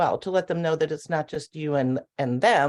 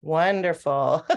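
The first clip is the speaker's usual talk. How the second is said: ordinary delivery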